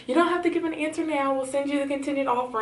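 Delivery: lightly imitated HR caller; lightly voiced HR lady. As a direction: breeze through it like a recruiter. Quoting HR lady calmly.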